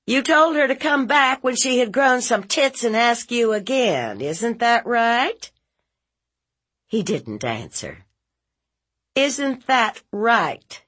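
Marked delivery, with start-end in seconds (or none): 0.1-4.2 high, loud accusation; accusing aunt. Acted. Aunt accusing nephew of insulting a girl, angry and high. 4.2-5.5 high, slow rising question; accusing aunt. Acted. Aunt demanding nephew confess, high and questioning. 9.1-10.8 high, slow, falling demand; insistent aunt. Acted. Aunt repeating her demand to silent nephew, insistent.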